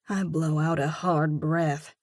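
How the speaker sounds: ordinary delivery, nothing marked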